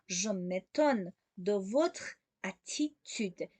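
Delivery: very slow, separating "Je m'étonne", "de votre", "attitude"; slow, word by word. Shown as a demonstration. Teacher repeats the model for learners, very slowly in separate chunks. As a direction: dictate "Je m'étonne de votre attitude" in small pieces.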